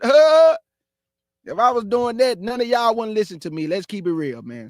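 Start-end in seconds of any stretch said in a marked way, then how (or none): 0.0-0.6 sudden high, loud squeaky "Huh?"; Pee Wee Herman squeak. Retold or imitated. Preacher showing how Pee Wee Herman talked, squeaky yelp. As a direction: yelp it like a goofy comic character. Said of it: "How Pee Wee Herman used to do it".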